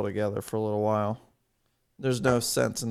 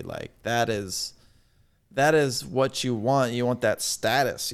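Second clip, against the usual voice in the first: normal talking voice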